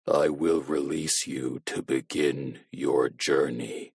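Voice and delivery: low, slow, soft machine voice; cold voice-changer promise; low robotic monotone. A narrator's reading. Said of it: "robotic, monotone voice".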